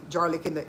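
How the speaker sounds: "garlic" starting with a J, rhyming it onto jar; garlic as jarlic. Retold or imitated. Explaining the creator's word for jarred garlic, "garlic" said jarlic. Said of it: "in the jar".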